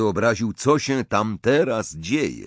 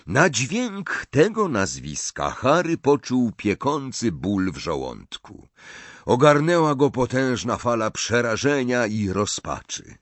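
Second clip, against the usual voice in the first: usual speaking voice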